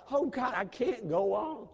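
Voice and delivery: high, loud, slow anguished plea; crying out to God. Retold or imitated. Grieving father crying out at his son's death, anguished and slow. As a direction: cry it out from grief, drawn out. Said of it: "I cried".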